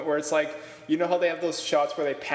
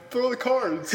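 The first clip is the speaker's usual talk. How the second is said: mock interviewer; 8 semitones higher, slower than usual. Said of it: "He's all like".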